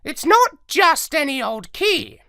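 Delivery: high, loud parrot voice, portentous; knowing parrot. A narrator's reading. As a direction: announce it like a know-all parrot. Parrot explains ominously.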